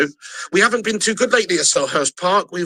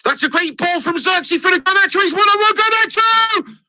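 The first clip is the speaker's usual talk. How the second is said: excited commentary; 10 semitones higher, 3 dB louder than usual. Acted. Fan calling his own match live.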